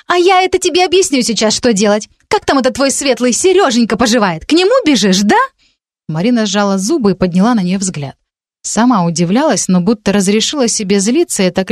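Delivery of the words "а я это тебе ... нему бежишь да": high-pitched taunting; sarcastic accusation. A narrator's reading. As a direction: needle her, pitch swinging up high. Friend sarcastically accuses her. (язвительное обвинение)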